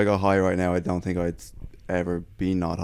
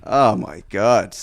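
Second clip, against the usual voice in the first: slow disbelief; 4 dB louder, much slower than usual. Retold or imitated. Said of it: "I was just like".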